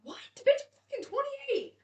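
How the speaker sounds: high, loud incredulous comeback; acted indignation. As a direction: pitch shoots up in mock outrage. Acted indignant reply about her age.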